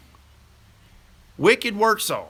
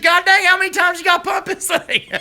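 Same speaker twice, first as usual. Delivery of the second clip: laughing mock yell; 10 semitones higher than usual. Retelling Stevie's frustrated shout.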